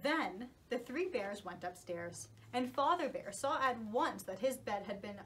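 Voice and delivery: usual speaking voice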